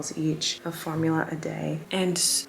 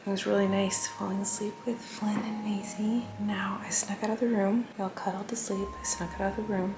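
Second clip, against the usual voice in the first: hushed bedtime whisper; quiet at night; 5 dB quieter than usual. Real, not acted. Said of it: "kind of like A S M R and, like, quiet".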